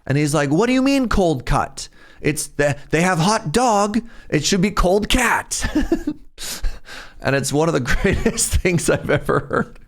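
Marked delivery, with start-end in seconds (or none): 0.5-5.7 high-pitched, protesting mock Italian accent; indignant Italian accent. Italian student disputing the teacher's correction, indignant mock Italian accent. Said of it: "his heavy Italian accent". 5.7-7.1 high, breathless giggle; laugh. Real, not acted. Storyteller cracking up at the cold cat line, giggling. 7.2-9.8 talking through giggles, pitched up; laughing. Real, not acted. Storyteller delighted by mother's anecdote, laughing through words.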